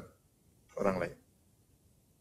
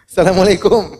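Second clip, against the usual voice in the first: loud hearty greeting; 7 semitones higher, 7 dB louder than usual. Acted. Fourth louder greeting.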